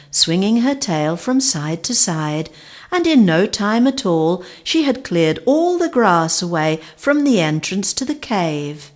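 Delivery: usual speaking voice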